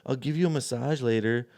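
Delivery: high whiny pleading. Retold or imitated. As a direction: whine it like a needy girl. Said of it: "She is all in her whiny voice".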